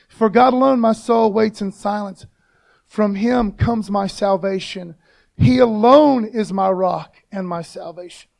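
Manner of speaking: loud, slow proclamation of a psalm; ringing, emphatic Bible reading; preacher reading scripture. Acted. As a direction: declare the verse out to the congregation. Reads the psalm aloud to congregation.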